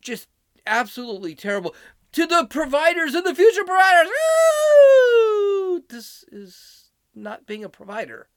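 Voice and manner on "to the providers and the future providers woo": high, loud hammed-up whooping and boasting; sarcastic over-the-top cheerleader; mock rally cheering. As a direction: yell it like a sneering parody of the crowd. Mocking the rally toast.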